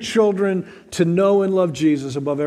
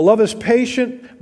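slow, higher solemn reading of scripture; 4 semitones higher, slower than usual. Acted. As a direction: lift the verse slowly and solemnly. Reads scripture aloud.

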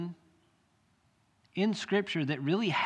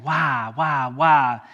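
pleading cry; 8 dB louder, much slower than usual. Acted. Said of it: "crying out to the Lord".

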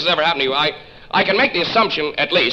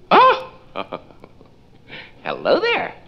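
ordinary delivery

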